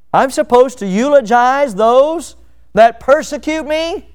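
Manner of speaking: high, slow, rising outrage; can't believe he's asked this; indignant disbelief. Retold or imitated. Preacher voicing a churchgoer, high and incredulous.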